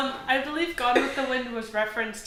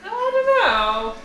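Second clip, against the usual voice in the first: playful drawl; 11 semitones higher, 7 dB louder, much slower than usual. Real, not acted. Playful doubt, drawn out.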